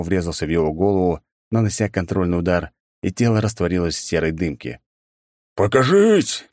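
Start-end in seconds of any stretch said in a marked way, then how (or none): 5.5-6.4 high, loud muffled helmet roar. A narrator's reading. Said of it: "снова начал догадываться".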